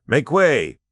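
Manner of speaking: high, loud yell. A narrator's reading. De Verck galloping through the camp, yelling at soldiers. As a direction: bark it out to clear the road. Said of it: "de Verck yelled".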